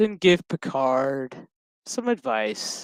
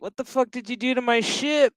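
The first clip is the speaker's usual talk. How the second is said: higher-pitched indignant demand; mock angry outburst; 10 semitones higher than usual. Pilot yelling at Seven about his dented ship, mock angry. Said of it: "And he's like".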